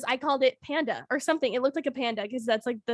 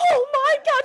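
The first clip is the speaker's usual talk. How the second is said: wailing; 13 semitones higher, 4 dB louder than usual. Retold or imitated. Bleeding finger, retold wail.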